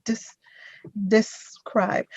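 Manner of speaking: "describe" broken after "dis-", then said whole; pronunciation model. Shown as a demonstration. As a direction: split off "dis-", pause, finish "describe" on the b. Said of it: "the pronunciation is describe".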